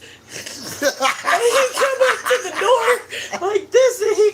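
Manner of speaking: high, loud words through laughter; helpless laughter. Real, not acted. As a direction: push the words out while cracking up. Cracking up while telling own story.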